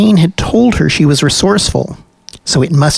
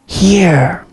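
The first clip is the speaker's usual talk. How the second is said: thin whispery spirit voice; breathy god calling from the wall; 4 semitones higher than usual. A narrator's reading. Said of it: "said the whispery voice".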